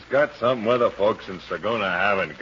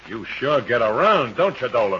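angry; 4 semitones higher, 6 dB louder than usual. Acted. Husband confronts wife's suitor.

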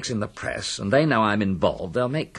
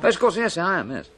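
higher, emphatic assurance; quick to insist, pitched up; insistent reassurance; 8 semitones higher than usual. Acted. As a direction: jump in to dispel the doubt. Hastens to reassure doubting inspector.

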